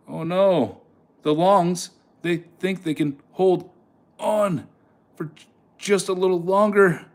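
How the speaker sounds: high, excited taunting of the longs; alarmed. Real, not acted. Live trade going against longs.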